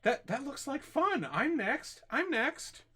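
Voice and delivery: high, loud, gleeful outburst; excited villager. Acted. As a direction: beg eagerly for your turn at the fun. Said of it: "looks at you excitedly".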